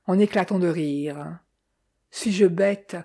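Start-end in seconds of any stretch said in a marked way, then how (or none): none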